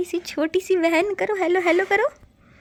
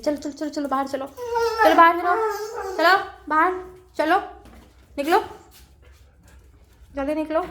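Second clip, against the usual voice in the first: shooing; 5 semitones higher than usual. Real, not acted. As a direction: drive the animals out. Shooing animals out. (जानवरों को ज़ोर से बाहर हाँकना)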